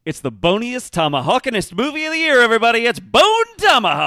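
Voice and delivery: booming high announcer yell, rising to a roar; dopey cartoon bellow. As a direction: bellow the title like a cartoon hype man. Hyped announcement of number two.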